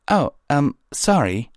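high, hesitant apology; flustered apology. A narrator's reading. Twilight apologises to the captain, high and flustered. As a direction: falter into a high-pitched sorry.

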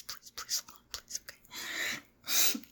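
barely audible whispered begging; hushed, frantic plea; desperate whisper. Acted. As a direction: plead under your breath.

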